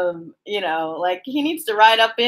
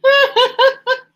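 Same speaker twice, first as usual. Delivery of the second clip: high, helpless, out of breath from laughing; breathless laughing; 9 semitones higher, 3 dB louder than usual. Real, not acted. Reader begging a moment to catch her breath, laughing. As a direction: gasp for air between giggles. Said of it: "Let me just catch my breath".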